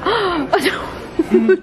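gasping oh no, high and laughing; surprised gasp. Real, not acted. Vlogger fumbling her burger, shocked laughing gasp. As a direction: caught off guard, half laugh half cry. Drops food, gasps laughing.

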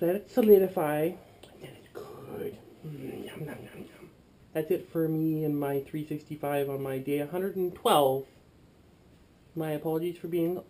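ordinary delivery

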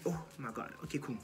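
breathy whisper under pressure; hushed oh my God in despair; whispered panic. Retold or imitated. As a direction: whisper it to yourself, stunned. Panic after counting time.

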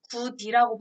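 구디 with a plain d; d kept before i in 구디; unpalatalized 굳이. Shown as a demonstration. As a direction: say "gu-di", exactly as 굳이 is spelt. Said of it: "발음하면 바보죠".

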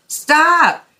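high, loud cry of stop; Blue yelling at the tangle of traffic; yelled. A narrator's reading. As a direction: call a halt with a shout. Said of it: "yelled Blue".

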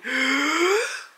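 loud, high gasping breath; sharp gasp for air. Real, not acted. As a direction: gasp as if winded. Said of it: "I can't say a sentence".

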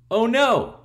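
high, loud "oh no"; alarmed cry. Acted. Reader reacting to the next wrestler, high alarmed cry. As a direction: gasp it out in mock alarm. Narrator reacts to new foe.

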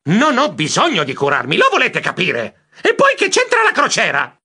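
exasperated, rising to high pitch; angry protest. A narrator's reading. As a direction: insist crossly, ending in a high outburst. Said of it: "mi morsi la coda dalla rabbia". (protesta rabbiosa)

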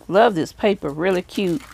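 normal talking voice

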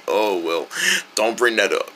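ordinary talk, nothing marked

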